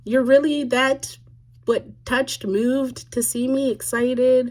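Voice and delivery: baffled side-eye at all the emotion; incredulous inner voice. Retold or imitated. Inner reaction to crying woman.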